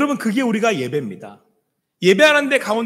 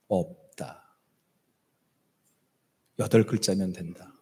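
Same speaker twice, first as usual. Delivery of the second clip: tender voice of God; 9 semitones lower than usual. Acted. God's voice speaking to speaker.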